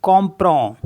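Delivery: कॉम्प्रो said the French way, open o, no English d; French word कॉम्प्रो, clearly sounded; French pronunciation. Shown as a demonstration. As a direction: spell it out, then say कॉम्प्रो in French. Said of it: "C O M P R E N D".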